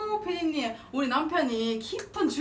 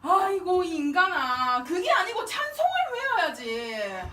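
normal talking voice

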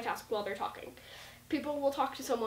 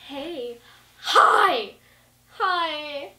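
each greeting pitched differently, last two higher and louder; voice keeps changing; 4 semitones higher, 11 dB louder, much slower than usual. Shown as a demonstration. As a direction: jump between voices on every word. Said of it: "they keep changing their voice".

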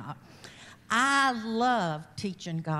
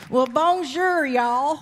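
loud, high bonjour in a southern drawl; southern-accented Creole; 5 semitones higher, 9 dB louder, much slower than usual. Real, not acted. Wife greets the congregation in her Creolish, thick southern accent. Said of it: "With my southern accent".

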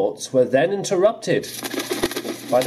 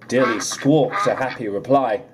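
ordinary delivery

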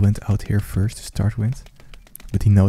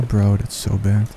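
ordinary talk

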